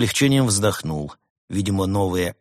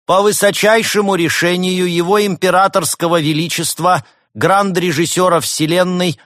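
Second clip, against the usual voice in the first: high, loud, pompous robot herald; herald's proclamation; 8 semitones higher, 8 dB louder than usual. A narrator's reading. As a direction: proclaim it grandly to the whole crowd. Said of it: "и объявил".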